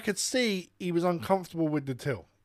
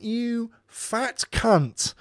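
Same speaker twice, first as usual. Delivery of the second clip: very slow, higher-pitched retold insult; lost temper; 6 semitones higher, much slower than usual. Storyteller turning on the abusive customer, temper lost. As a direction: draw out each word in re-enacted outrage. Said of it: "I just lost my temper".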